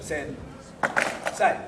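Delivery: Sen, a full second's gap, Say; pause mid-word. Shown as a demonstration. Teacher showing how not to say the word, split by a pause. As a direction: break Sen from Say with a silence. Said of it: "that's not how we say the word".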